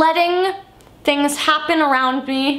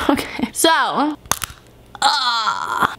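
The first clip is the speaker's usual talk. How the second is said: usual speaking voice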